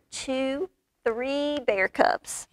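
usual speaking voice

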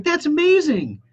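high, slow, marvelling; excited customer. Retold or imitated. Customer on the phone gushing, high and slow. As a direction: stretch out the amazement. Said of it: "they're genuinely excited".

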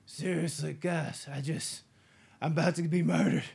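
mock pleading. Seagal begging the massaging doctor, loud mock plea. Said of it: "And Seagal's like".

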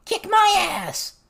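very high, squealing shout; loud, shrieked goblin heckle; goblin yell. A narrator's reading. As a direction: yell it back as a taunt. Goblin heckler yells back in brawl.

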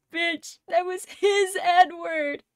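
loud, slow, high insistence; excited. Real, not acted. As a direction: hammer home the point. Defending the character.

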